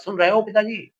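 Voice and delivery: high call to a dead father; a son calls out to his slain father. Acted. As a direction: cry out across to a lost father. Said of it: "आवाज लगा रहा है".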